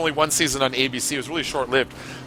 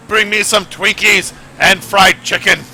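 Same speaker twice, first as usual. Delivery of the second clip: slow, loud, hammed-up demand; dying wish shout; 4 semitones higher, 6 dB louder, slower than usual. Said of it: "I'm, like, gonna be like".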